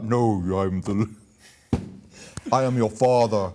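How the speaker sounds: Darth Vader. Pretend. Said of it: "You can be like".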